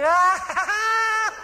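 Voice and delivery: loud, high-pitched imitated guffaw; mock hysterical laughter. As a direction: shriek with put-on laughter at a ridiculous idea. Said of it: "lying on top of his bed like a dead chicken".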